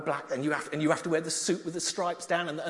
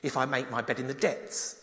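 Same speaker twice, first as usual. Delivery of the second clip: measured, reverent psalm reading; scripture reading; 4 semitones lower than usual. Acted. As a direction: read the psalm to the congregation. Reads Psalm 139 aloud.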